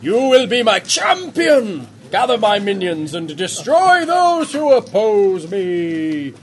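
slow, grand talking badger; booming badger statue. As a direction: proclaim it like an ancient beast. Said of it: "It says".

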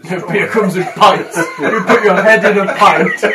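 slow, loud and laughing. Real, not acted. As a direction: tease her through laughter. Teasing loud explanation.

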